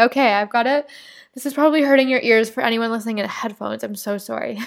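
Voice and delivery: ordinary delivery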